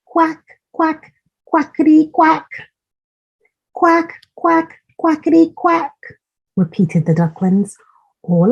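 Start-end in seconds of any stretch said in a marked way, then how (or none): 0.0-5.9 high, bouncy quacks; high quacking. A narrator's reading. Reading to a child, ducklings practising quacks, high and bouncy.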